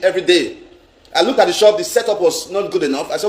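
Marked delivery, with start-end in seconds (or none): none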